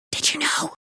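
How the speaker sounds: whisper. Acted. Echoing the secret.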